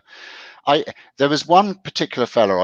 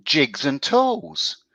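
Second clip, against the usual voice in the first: segment announcement; 5 semitones higher, much slower than usual. Acted.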